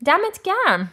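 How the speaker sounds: high, spirited compliment; enthusiastic approval. Acted. Acted approving "right on, man" reply.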